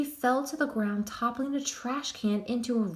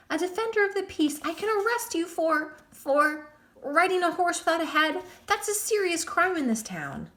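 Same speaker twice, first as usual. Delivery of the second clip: much higher, fast nervous bluster; terrified bluster; 9 semitones higher, 3 dB louder than usual. A narrator's reading. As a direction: pretend courage with a shaking voice. Crane blustering while terrified.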